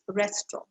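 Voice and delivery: restaurant as res-tro, end barely sounded; silent NT. Shown as a demonstration. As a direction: let the NT of restaurant vanish. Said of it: "You don't really hear that sound".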